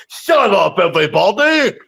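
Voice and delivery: loud, arrogant wrestler yell; mock heel shout. Cocky heel yelling at the whole crowd, loud. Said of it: "he stood up and went".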